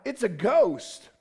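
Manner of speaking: slow, loud terrified cry; cried out in fear. A narrator's reading. Disciples cry out in fear at the figure on the water, loud and slow. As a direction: cry it out like you've seen a ghost. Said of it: "they cried out".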